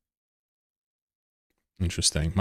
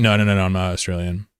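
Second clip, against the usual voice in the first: ordinary delivery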